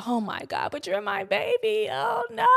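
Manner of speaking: mock mom pleading to her baby; whiny clingy mom. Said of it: "she's like".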